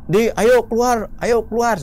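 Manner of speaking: high, loud call to come out; retold shout. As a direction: holler urgently for your friend outside. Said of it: "saya teriak". (teriak memanggil teman dengan nada tinggi)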